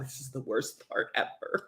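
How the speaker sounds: unvoiced glum mutter; gloomy whisper. Shown as a demonstration. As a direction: sound deflated and grim.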